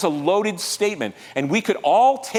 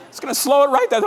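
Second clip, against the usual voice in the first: higher and louder mock whine; mock exasperation; 8 semitones higher, 4 dB louder, faster than usual. Preacher acts out a listener who wants to win fast, louder. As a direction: play the impatient fighter. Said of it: "exaggerated complaint".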